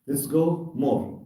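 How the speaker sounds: ordinary talk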